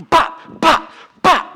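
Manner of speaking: high, sharp mock "bop" hits; imitated smacking. Pastor imitating Christians smacking people with Bibles, mock whacks.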